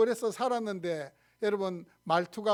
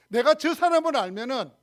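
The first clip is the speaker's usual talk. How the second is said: loud, higher-pitched vehement oath; self-cursing oath; 5 semitones higher, 8 dB louder than usual. Acted. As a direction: swear loudly against yourself, as Peter. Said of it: "자신에 대해서 저주하는 말". (큰 소리로 높여 저주하듯 맹세)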